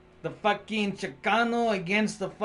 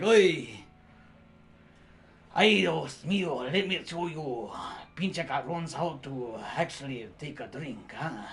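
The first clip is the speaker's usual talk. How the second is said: put-on Spanish accent; 3 semitones lower than usual. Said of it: "the Spaniard comes around and is like".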